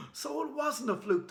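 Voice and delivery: high, amazed; a rush of disbelief; excited thought. Acted. Boy realising the caveman speaks, excited. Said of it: "thought Connor".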